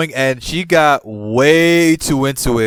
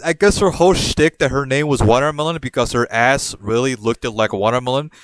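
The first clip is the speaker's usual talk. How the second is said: ordinary talk, nothing marked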